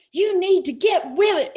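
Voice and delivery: loud, high, angry yell; retold yelling. Friend Bear yelling at her on the phone, loud and high. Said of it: "he's just yelling at me".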